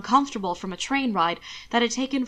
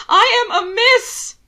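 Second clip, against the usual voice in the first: high, loud, slow desperate cry; 13 semitones higher, 10 dB louder, slower than usual. Acted. Alana in labor cries out at the midwife, loud and high. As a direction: scream it out in frustration and fear. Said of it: "Alana cried".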